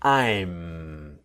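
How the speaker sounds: "I'm" with the lips pressed firmly on the m; strong "I'm". Shown as a demonstration. Teacher models "I'm" for students, lips pressed firmly. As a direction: close the lips hard on "I'm". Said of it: "the lips touch very strong".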